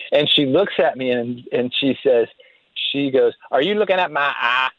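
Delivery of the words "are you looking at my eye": mimicking a Southern woman's challenge; mock Southern wife confronting someone; Southern accent, imitated wife. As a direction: put on a Southern twang for the question. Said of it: "he had this real Southern accent".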